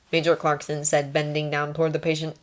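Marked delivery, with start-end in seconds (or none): none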